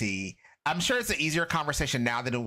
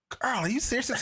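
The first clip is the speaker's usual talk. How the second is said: higher, a playful mock disbelief; disbelieving; 10 semitones higher than usual. Speaker reacting to her friend's news, higher and mock disbelieving. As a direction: pitch it up, teasingly incredulous. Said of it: "I'm like".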